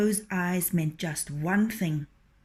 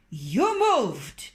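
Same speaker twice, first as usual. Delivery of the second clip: loud, sharp rooster accusation; suspicious rooster; 3 semitones higher, 5 dB louder than usual. Acted. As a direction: catch the fox out.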